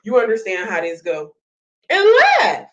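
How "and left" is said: high, loud outburst; shout. Real, not acted. Ranting vlogger shouts at Peach, loud. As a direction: yell it out.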